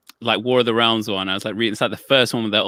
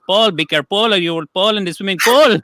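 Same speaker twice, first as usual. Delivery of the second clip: higher-pitched Filipino accent, F's turned to P's; 8 semitones higher, 4 dB louder than usual. Shown as a demonstration. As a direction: say "Paul, careful, fall, pool" all as "pol", Filipino accent. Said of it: "Filipino's the only accent where you can say Paul".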